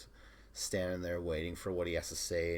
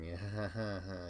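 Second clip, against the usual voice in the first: imitated forced polite laugh; put-on, obliging chuckle; 4 dB quieter than usual. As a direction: fake a small courteous ha-ha-ha. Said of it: "a chuckle, you know, polite".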